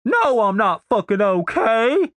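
drawn-out, high-pitched angry shout; indignant cry from inside a bundle; muffled shout. Acted. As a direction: yell slowly from under cloth. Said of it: "Denise's shout was muffled".

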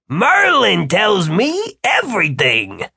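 high, drawn-out boast through a smile; pleased, slow and high-voiced underling; smiling Eddie. A narrator's reading. Eddie answers Martin and Gwen, smiling.

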